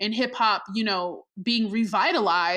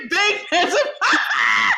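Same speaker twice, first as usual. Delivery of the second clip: laughing shout; 13 semitones higher, 5 dB louder than usual. Real, not acted. Repeating the song title to her cohost, laughing loudly. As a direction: say it again, cracking up.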